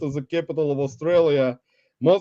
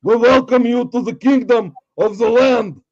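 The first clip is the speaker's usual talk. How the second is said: high, loud ceremonial proclamation; Hungry Shark shout; 5 semitones higher, 5 dB louder than usual. Acted. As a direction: bellow a hammed-up royal welcome. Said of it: "here's from Hungry Shark".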